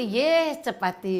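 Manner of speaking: slowly spelling out the word chapati, each syllable drawn long; मुझे सिखाते हुए धीरे धीरे; slow teaching. Retold or imitated.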